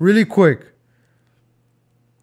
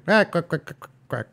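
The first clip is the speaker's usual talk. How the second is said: rapid mock quacks trailing off softer; imitated quacking; 2 semitones higher, 5 dB quieter than usual. Teasing the penguin over here, imitated quacks. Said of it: "The penguin over here".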